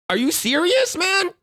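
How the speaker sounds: high, disbelieving are you serious; incredulous outburst. Acted. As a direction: pitch it up in mock disbelief. Playing the outraged driver.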